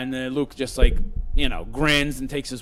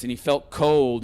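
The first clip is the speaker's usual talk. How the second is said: ordinary talk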